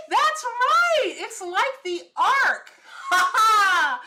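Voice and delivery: loud, high whoop breaking into laughter; elated, laughing. Real, not acted. Vlogger thrilled by a viewer's insight, elated and laughing. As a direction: cry out in delighted agreement, then laugh.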